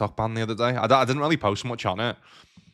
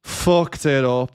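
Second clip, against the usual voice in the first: slow, louder, drawn-out groan of a phrase; mock lament; 4 semitones higher, 6 dB louder, much slower than usual. Streamer to chat, slow hammed-up lament. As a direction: ham up the regret. Repeats his mistake dramatically.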